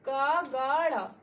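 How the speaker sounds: "का, गा, ड़ा" said one by one, each syllable clear and held apart; sounding out letters. Shown as a demonstration. Teacher to students, sounding out the का group syllable by syllable. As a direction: spell out "का", "गा", "ड़ा" as separate syllables. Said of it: "का वर्ग में देखिए".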